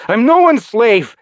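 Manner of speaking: high, loud defiance; defiant. Acted. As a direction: throw off the master's chains. Rebel defies former master.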